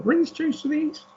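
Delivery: high-pitched film-character imitation; Mary Poppins. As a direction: lift it into a high put-on voice. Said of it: "I'm thinking about Mary Poppins".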